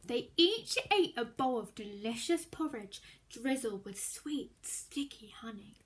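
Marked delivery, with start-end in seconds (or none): none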